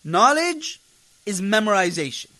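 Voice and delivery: ordinary delivery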